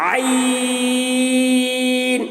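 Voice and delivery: AYN from an open throat, held long on one note; drawn-out Ayn. Shown as a demonstration. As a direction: chant آئین with a long, level stretch. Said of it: "ایسے۔".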